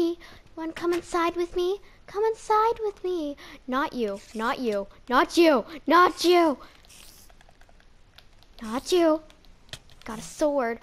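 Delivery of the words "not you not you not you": louder, firm no; loud shooing. Real, not acted. To the mob, a loud firm shoo. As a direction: shove them off with your voice.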